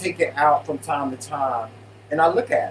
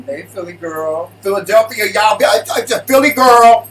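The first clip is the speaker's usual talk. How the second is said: loud, high mock outburst; playful calling-out; 6 semitones higher, 11 dB louder than usual. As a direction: holler at a friend in mock outrage. Playful telling-off of Philly girl.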